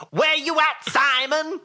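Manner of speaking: high mimicked shout; Sean O'Malley. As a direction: shout it in a high put-on voice, mock accent. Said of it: "he put on a voice when he did it".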